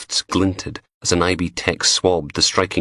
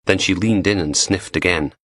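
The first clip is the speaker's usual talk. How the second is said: usual speaking voice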